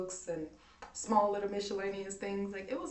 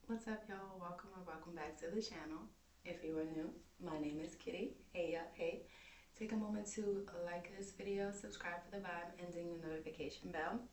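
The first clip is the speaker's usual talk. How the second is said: quiet voice; 8 dB quieter, faster than usual. Real, not acted. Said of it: "I do hope that I'm talking loud enough".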